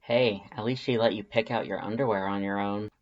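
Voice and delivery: usual speaking voice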